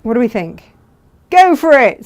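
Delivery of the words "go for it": lightly voiced cheering viewer, higher and louder; reading a viewer's comment. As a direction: read it as an upbeat fan. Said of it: "says Elizabeth".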